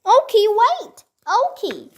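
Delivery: high urgent call after a runaway dog; shouting. A narrator's reading. Annie shouting after the fleeing puppy, urgent. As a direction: yell after him as he runs. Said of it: "shouted Annie".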